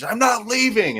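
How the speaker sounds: high-pitched mock shout of refusal; mock yell. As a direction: squeal it like a stubborn veteran. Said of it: "He's like".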